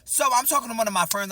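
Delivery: ordinary delivery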